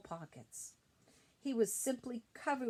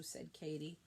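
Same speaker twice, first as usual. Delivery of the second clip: ordinary delivery, nothing marked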